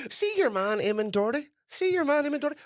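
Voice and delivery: high, hammed-up gossiping musician; nosy musician voice. At the bar, a musician mocking a rival's fee, high and nosy. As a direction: pitch it up like a jealous rival asking around. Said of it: "musicians are going on the bars going".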